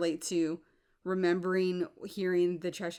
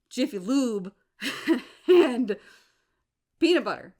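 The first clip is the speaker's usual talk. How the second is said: laughing through the list; 3 semitones higher, 7 dB louder than usual. Real, not acted. Scoffing at people who say she's confused, laughing. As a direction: burst of laughter mid-list.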